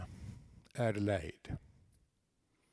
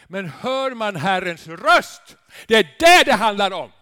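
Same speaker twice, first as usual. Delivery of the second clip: preacher's shout; 8 semitones higher, 16 dB louder than usual. Acted. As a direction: cry it out to the congregation. Climax of sermon. (ropar högt och långsamt)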